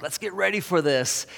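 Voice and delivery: imitating an excited crowd, pitched high; pumped up. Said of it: "They were excited. They were just pumped up".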